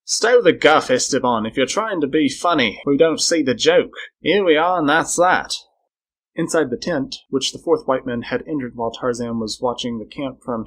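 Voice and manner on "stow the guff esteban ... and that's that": loud, exasperated telling-off; exploding. A narrator's reading. Englishman fed up with Esteban's act, exploding. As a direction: snap at him loudly, out of patience. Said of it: "exploded the other Englishman".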